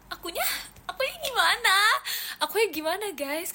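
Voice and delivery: high, petulant whine; whiny sulk. Acted. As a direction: pout and demand attention. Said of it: "aku sedih banget sih".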